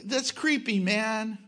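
mocking atheist voice. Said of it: "the unbelieving world, when, before I was saved".

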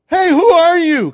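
high, loud startled yell; startled shout. Retold or imitated. Preacher acts Boaz startled at midnight, startled shout. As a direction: shout it out as if woken by an intruder. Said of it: "he was startled like".

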